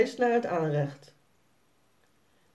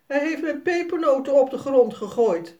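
boos kind; 7 semitones higher, 6 dB louder than usual. A narrator's reading. Sanne klaagt boos.

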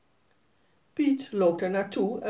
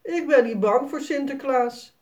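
lightly voiced tough little boy, higher; stoer; 6 semitones higher, 5 dB louder than usual. A narrator's reading. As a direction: act brave like a boy puffing up. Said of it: "zegt Jasper stoer". (lichtjes stoer jongetje, hoger)

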